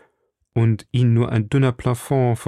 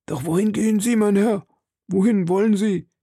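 alarmed servant; 10 semitones higher than usual. A narrator's reading. Planchet alarmed asks master.

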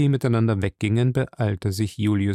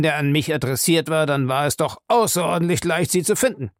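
raised, emphatic scolding; scornful old man; 6 semitones higher, 3 dB louder than usual. Acted. Old man tells her off over stolen letters, scornful. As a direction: berate her with impatient emphasis.

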